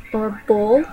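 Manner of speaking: "boil" squeezed into one syllable, "bull"; Southern "bull" for boil. Shown as a demonstration. Speaker demos the Southern "boil" as "bull". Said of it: "That would be the Southern version".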